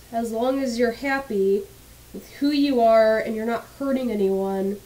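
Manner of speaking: ordinary delivery, nothing marked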